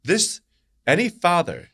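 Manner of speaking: high-pitched, incredulous outrage; real outrage. Real, not acted. Commentator asking why anybody defends stoning, outraged. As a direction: demand an answer, voice pitched right up.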